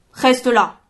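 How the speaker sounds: loud curt order; harsh barking commands. A narrator's reading. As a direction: bark it, sharp and short. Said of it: "ordonna sèchement Kenner".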